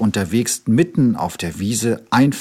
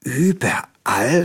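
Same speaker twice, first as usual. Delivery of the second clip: surprised question; 3 semitones higher, slower than usual. A narrator's reading. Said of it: "fragte der kleine Bär".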